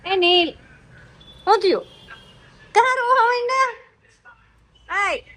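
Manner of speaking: high, loud mock concern; loud teasing. Real, not acted. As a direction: tease him for crying, loudly. Teasing Neel who is crying. (ઊંચા અને મોટા અવાજે ચીડવવું)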